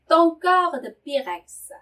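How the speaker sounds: careful teacher reading; slow model reading. Shown as a demonstration. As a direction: read it for learners. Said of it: "slow model reading".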